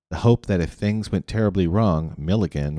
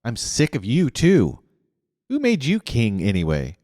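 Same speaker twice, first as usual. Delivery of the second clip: angry retort; 5 semitones higher than usual. Acted. Constance lashing out at Rainey.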